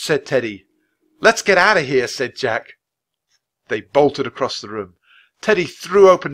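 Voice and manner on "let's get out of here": high, loud and panicky; urgent alarm. A narrator's reading. Jack wanting out of the haunted room, urgent alarm. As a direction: urge everyone to flee.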